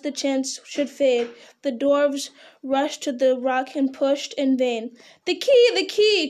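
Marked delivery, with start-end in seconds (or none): 5.2-6.3 high, urgent outcry; excited cry. A narrator's reading. Bilbo crying out to the dwarves for the key, urgent high cry. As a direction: cry it out much higher, with rising urgency. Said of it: "cried Bilbo".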